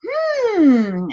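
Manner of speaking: high, drawn-out musing hmm; curious hmm. Shown as a demonstration. Coach showing clients how to question instead of dismiss, a musing hmm. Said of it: "turn around and go".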